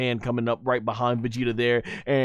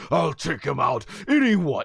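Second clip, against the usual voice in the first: Vegeta; 2 semitones higher than usual. Retold or imitated. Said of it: "He says".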